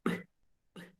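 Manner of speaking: B as a bare voiced b burst with no vowel; just the lip burst of B, no name; phonics sound of B. Shown as a demonstration. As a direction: som do B sem vogal. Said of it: "O som do B é".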